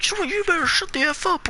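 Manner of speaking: high, squeaky mock voice; exaggerated high-pitched taunt; mocking imitation. Mocking the people cursing at her.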